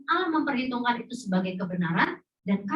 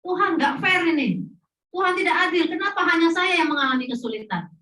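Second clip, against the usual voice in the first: higher, louder, shouting Tidak adil; angry complaint to God; 6 semitones higher, 3 dB louder than usual. Retold or imitated. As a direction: rage at God like a wronged believer. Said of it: "Sering ngomong gitu ya". (protes marah kepada Tuhan, berteriak)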